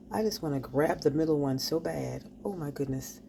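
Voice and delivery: usual speaking voice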